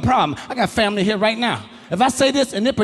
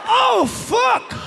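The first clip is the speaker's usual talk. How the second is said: shocked outburst; 11 semitones higher than usual. Acted. Reaction after the phone call.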